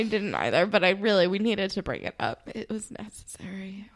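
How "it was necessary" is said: hushed, voiceless whisper; whispered aside. Real, not acted. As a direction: whisper it as a confession. Hushed aside about an awkward scene.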